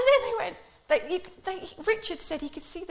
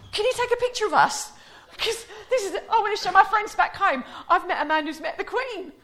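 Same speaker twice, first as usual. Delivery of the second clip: high, loud, laughing through the request; hammed-up tourist excitement; eager American tourist; 8 semitones higher, 10 dB louder than usual. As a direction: beg for the photo like a starstruck tourist. American tourist asking for photo.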